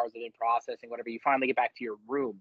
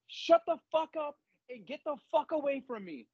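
much higher, harsh rebuff; drill sergeant snap; 11 semitones higher than usual. Retold or imitated. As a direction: shut her down like a drill sergeant. Drill sergeant rebuffs crying recruit.

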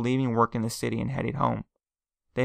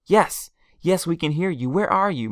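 higher and louder, anxious rush; desperate to reach him; urgent phone reply; 6 semitones higher, 4 dB louder than usual. A narrator's reading. Aunt anxiously asking her husband where he is over the phone.

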